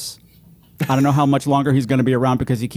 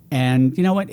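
usual speaking voice

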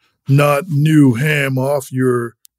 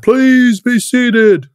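town crier; 9 semitones higher, 3 dB louder, much slower than usual. Acted. Herald seats the crowd.

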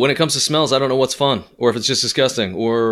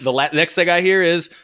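ordinary talk

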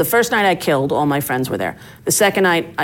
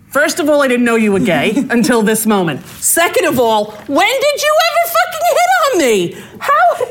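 shrill, laughing disbelief climbing high; mock outrage that breaks into laughter; squeaky high indignant laugh-talk; incredulous retort; 9 semitones higher than usual. Retold retort to woman's claim.

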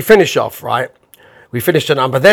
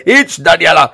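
put-on Italian-accented host; 3 semitones higher, 4 dB louder than usual. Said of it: "He went".

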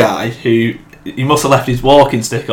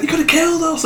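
mock outraged; 5 dB quieter, faster than usual. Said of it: "the guy's like".

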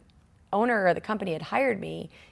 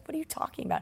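whispered and softer, baffled; hushed puzzled whisper; 9 semitones higher, 7 dB quieter, faster than usual. Retold or imitated. Assistant to the dizzy buyer outside, hushed puzzled whisper. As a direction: breathe it like a puzzled coworker.

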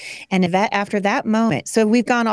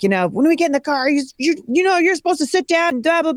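higher, exasperated mock scolding; frustrated mom; 9 semitones higher than usual. Tired mom lecturing her kids about the car, exasperated mock scolding. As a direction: nag the kids, half-hearted and fed up. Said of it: "I'm so frustrated".

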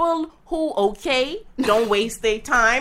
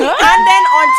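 laughing; 11 dB louder than usual. Real, not acted. Laughing outburst.

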